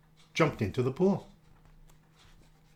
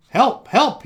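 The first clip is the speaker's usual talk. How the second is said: loud, high cry for help; radio call for help; 9 semitones higher, 10 dB louder than usual. Acted. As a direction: shout it over the radio.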